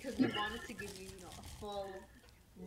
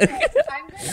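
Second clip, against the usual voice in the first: laughing; 14 dB louder, faster than usual. Real, not acted. Laughing at pathetic burger.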